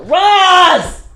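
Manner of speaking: loud, high drawn-out shout; calling out. Real, not acted. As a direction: yell the name across the house. Calling Roz in another room.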